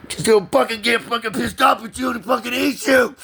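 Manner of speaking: high, loud screamed warning; furious tearful rant. Real, not acted. Warning what an angry computer will do, screaming in tears. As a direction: scream the threat through tears. Ranting threat through tears.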